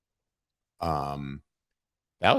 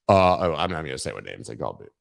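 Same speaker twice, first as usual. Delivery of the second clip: ordinary delivery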